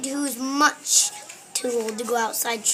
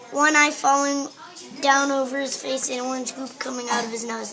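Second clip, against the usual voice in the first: usual speaking voice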